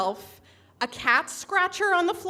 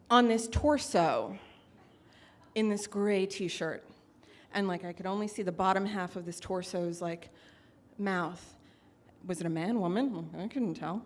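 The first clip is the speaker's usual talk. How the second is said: hushed suspense; 7 semitones lower, 7 dB quieter than usual. Acted. Building suspense in the story.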